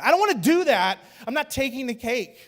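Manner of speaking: high, loud comic protest; emphatic refusal. Acted. Acts sister's exaggerated refusal.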